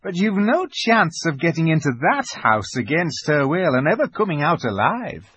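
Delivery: kindly beaver wife, firmly discouraging; Missus Beaver. A narrator's reading.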